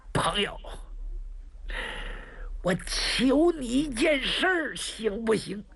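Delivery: speaking through clenched teeth. Acted. Wounded stranger gritting his teeth to beg the hero. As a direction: force each word out against the pain. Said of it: "强咬着牙说出几句话来".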